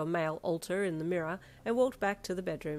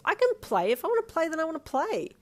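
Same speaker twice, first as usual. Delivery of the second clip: high, loud defiant outburst; firm indignation; 12 semitones higher, 6 dB louder than usual. Acted. Protesting the male-only rule to Darby, firmly and loudly. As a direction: protest hard, hammering each phrase. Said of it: "you stated firmly".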